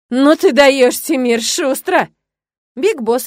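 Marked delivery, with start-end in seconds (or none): 0.1-2.1 loud, higher-pitched teasing cheer; amused roar. A narrator's reading. Timur greets his brother's arrival with girl, loud amused roar. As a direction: crow it out loudly, slowing on the last words. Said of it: "начинает громко смеяться, вернее, даже ржать".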